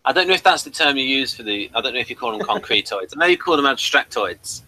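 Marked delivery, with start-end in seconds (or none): none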